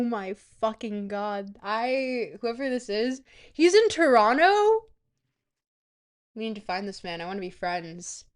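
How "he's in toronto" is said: high, loud disbelief; can't believe it's Toronto; shrill-free astonished question; surprised. Real, not acted. Shocked realisation.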